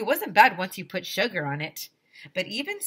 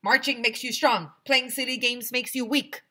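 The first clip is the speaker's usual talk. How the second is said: louder, higher strict preaching; stern lecture; 6 semitones higher, 5 dB louder than usual. Acted. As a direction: lecture the kids. Principal lectures.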